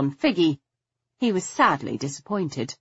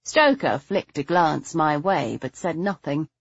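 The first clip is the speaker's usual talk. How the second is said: ordinary delivery, nothing marked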